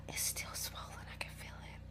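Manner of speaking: real gasping whisper of discovery; stunned whisper. Real, not acted. Feels sore spot mid-story.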